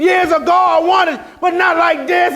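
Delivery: hammed-up lament. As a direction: shout it to the congregation. Recalling wanting her.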